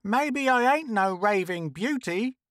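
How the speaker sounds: high, aggrieved character voice; high-pitched huffy retort; indignant Trixie. A narrator's reading. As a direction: protest in a high voice. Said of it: "Trixie continued".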